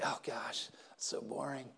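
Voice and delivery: low, quiet mock boredom; bored groan. Newcomers griping about general service, bored. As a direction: imitate a bored complainer under your breath. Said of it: "hear people say".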